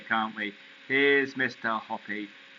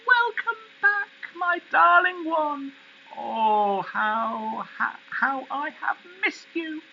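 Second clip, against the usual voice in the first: very high, gushing cry of welcome; joyful cry; 4 dB louder than usual. A narrator's reading. Missus Silver greeting her tortoise after winter, crying out with joy. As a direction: cry out high with delight, doting on a pet. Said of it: "and cry out".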